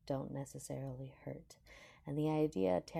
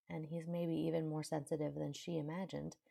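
plain reading voice

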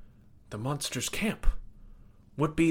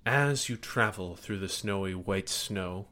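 normal talking voice

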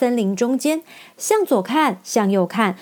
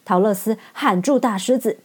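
ordinary delivery, nothing marked

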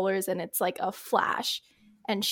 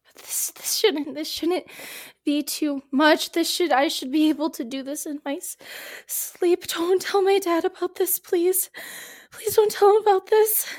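1 pleading panic; 8 semitones higher than usual. Acted. Pilot begs instructor not tell dad.

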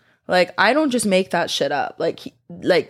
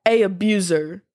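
mock quoting commenter. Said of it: "Someone said".

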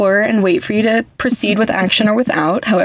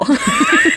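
high squeaky laugh. Real, not acted. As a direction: giggle way up high.

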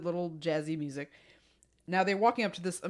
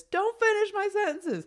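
snappy sister; 6 dB louder than usual. Retold or imitated.

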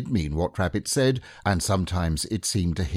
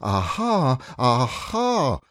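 Pooh tries out a bold, higher Aha; practising aha; 6 semitones higher, 3 dB louder, much slower than usual. A narrator's reading. Pooh practises his line for the plan, bold and trying. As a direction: rehearse the word as if trying it on.

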